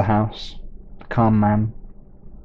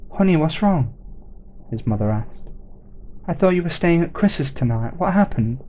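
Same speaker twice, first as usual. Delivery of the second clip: higher, concerned mother; mother's voice; 6 semitones higher than usual. Acted. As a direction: ask gently like a worried mum.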